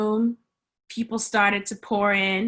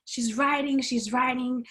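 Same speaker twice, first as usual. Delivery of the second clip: ordinary delivery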